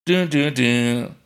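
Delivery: slow "dun, dun, dun"; suspense sting. Pretend. Drum-roll sound before announcing score.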